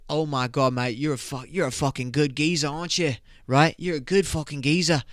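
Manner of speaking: put-on British lad praising a mate; mock British accent. Brother praises the guy as geezer, mock British accent. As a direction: gush in a hammed-up British accent. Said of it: "And then I'm like".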